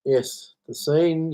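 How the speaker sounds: ordinary talk, nothing marked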